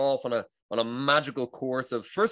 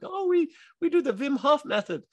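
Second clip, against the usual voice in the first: much higher, imitating a Dutch accent; Dutch lady impression. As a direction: mimic a chirpy Dutch woman with a Dutch accent. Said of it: "there was these two ladies there".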